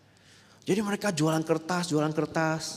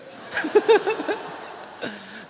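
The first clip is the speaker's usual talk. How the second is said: high breathy laugh. Real, not acted. Preacher laughs at punchline.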